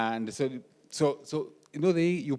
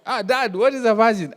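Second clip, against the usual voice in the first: put-on excited child question; 9 semitones higher, 8 dB louder than usual. Child blurts question at dinner.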